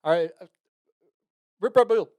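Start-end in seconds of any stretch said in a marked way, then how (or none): 1.6-2.1 mock tribute. Real, not acted. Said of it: "playful sign-off".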